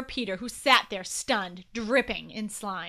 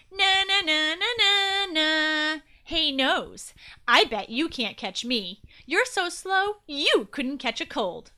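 teasing playground chant, pitched up; sing-song taunt; 6 semitones higher, 4 dB louder, slower than usual. A narrator's reading. Will baiting the runaway nose, taunting. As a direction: goad them with a mocking, higher lilt.

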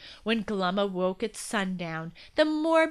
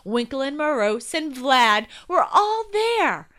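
ordinary delivery, nothing marked

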